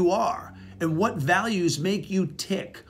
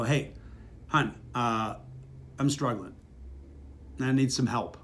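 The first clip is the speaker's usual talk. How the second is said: softer, lower humble plea; soft vulnerable ask; 4 semitones lower, 7 dB quieter than usual. Shown as a demonstration. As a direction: ask gently, admitting you're struggling. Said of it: "How about you say it like this".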